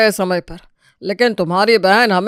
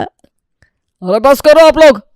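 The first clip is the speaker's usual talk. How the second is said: loud, slow, higher plea to stop; exasperated shout; 6 semitones higher, 8 dB louder, much slower than usual. Acted. Father stopping the wife and grandmother's fight, loud exasperated shout. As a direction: shout everyone down, drawn out.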